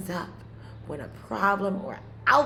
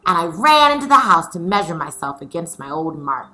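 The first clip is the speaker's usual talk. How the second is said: louder, lively storytelling voice; story reading to children; 11 dB louder than usual. A narrator's reading. Teacher reading chapter four to her scholars, lively. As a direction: read it out like a picture book for kids. Reads the story aloud to class.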